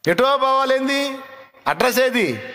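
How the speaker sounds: high, slow incredulous query; mocking bystander. Friends puzzled by a journey with no address, high mock query. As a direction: act out a baffled listener asking for the address.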